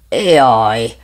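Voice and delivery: loud jolt of fright; startled cry. Acted. Both dogs cry out as they collide, loud. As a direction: yelp as they bump into each other. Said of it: "ordítottak".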